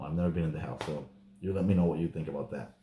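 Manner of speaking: ordinary talk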